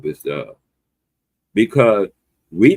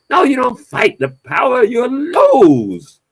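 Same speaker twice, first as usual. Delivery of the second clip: emphatic shout; 5 dB louder, slower than usual. Real, not acted. Rebutting the slogan emphatically.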